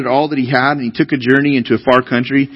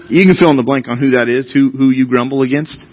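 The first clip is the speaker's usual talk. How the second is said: ordinary talk, nothing marked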